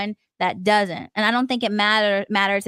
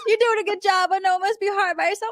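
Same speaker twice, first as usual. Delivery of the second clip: imitated supportive mother; 11 semitones higher, faster than usual. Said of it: "she's like, she goes".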